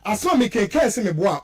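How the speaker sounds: usual speaking voice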